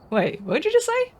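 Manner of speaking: shocked disbelief at a strange word; retold surprise. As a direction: react like you can't believe your ears. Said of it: "and I was like".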